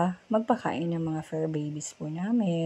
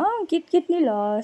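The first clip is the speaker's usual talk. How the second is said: high, sing-song cooing; 10 semitones higher than usual. Real, not acted. Owner gushing to her puppies, high baby talk. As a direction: gush over the puppies.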